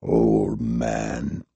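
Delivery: low, soft, thick-voiced threat. A narrator's reading. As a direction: push the words out through pain. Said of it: "he said thickly".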